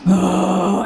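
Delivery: loud, long noisy sniff into a sigh; huge ecstatic inhale. Acted. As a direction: breathe the moss in hard and noisily. Face in moss, sniffing it in.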